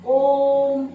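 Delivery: ओम held three times as long, loud and full; long sung-out ओम as in a mantra; drawn-out Om. Shown as a demonstration. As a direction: stretch ओम like a chant. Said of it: "तो इसको बोलने में तीन गुना समय लगता है".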